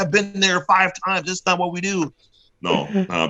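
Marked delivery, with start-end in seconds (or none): 0.0-2.1 high-pitched, indignant know-it-all; mock younger guys. Host mimicking younger wrestlers who contradict him, mock indignant. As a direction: imitate a protesting young wrestler. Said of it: "the younger guys".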